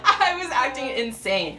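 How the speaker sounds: high, slow laughing confession; laughing admission. Real, not acted. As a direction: admit it while cracking up. Laughing at own past behaviour.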